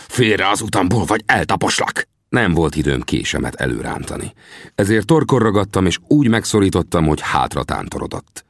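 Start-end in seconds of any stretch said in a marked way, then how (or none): 0.1-2.1 tense, venomous threat; hissed threat. A narrator's reading. Tangua orders the narrator aside, menacing hiss. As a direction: threaten him through a tight, angry voice.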